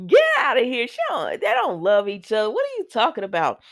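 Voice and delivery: hammed-up retold protest; mock incredulity. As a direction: act out a loud, high-pitched scoff. Acted incredulous reply to love claim.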